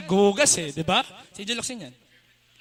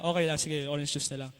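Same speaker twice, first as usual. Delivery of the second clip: softer, lower, deflated settling; softly giving in to the cashier; resigned; 6 semitones lower, 6 dB quieter than usual. Retold or imitated. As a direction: give up and take the orange juice quietly. Settling after no ice.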